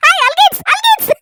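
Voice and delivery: high, playful sing-song calling of the name; teasing call. Acted. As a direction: tease him with the new nickname. Wife calls husband by nickname.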